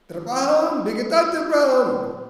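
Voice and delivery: high, loud, drawn-out verse recitation; ringing slow recital, pitched well up; loud declaiming. Acted. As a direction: proclaim the sacred words slowly at full voice. Declaims quoted hymn words aloud.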